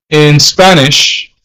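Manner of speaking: ordinary talk, nothing marked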